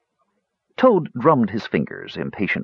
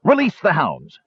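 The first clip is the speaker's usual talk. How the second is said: a hammed-up call to unleash the dogs; shouted command; 6 semitones higher than usual. Toad orders the hounds released, mock shout. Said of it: "and so he shouted".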